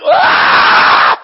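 high, loud, breathless cry; unvoiced screech of fervor; ecstatic preaching yell. Real, not acted. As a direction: let out a wild holy shout. Preacher erupts mid-sermon.

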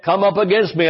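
normal talking voice

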